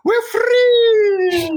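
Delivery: tiny high squeaky cheer; mosquito voice. Pretend. Voicing mosquitoes escaping the pot with a high cheer. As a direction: squeal it like freed bugs.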